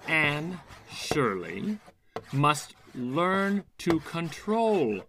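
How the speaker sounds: slow dictation. Acted. Teacher writes slowly on board.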